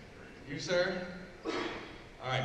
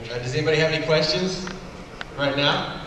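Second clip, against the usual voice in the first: louder, projecting to the whole room; calling out to room; 6 dB louder than usual. Real, not acted. Host getting everybody ready to record, loud and upbeat.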